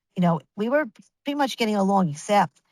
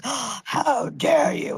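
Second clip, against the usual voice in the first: slow, drawn-out, higher mock indignation; dramatic outrage; 5 semitones higher, much slower than usual. Mimicking the opposing lawyer's Oscar-worthy outrage, hammed up. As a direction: ham it up like a stage actor playing offended. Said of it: "very dramatic".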